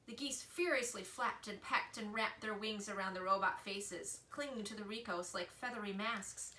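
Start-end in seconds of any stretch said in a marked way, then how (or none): none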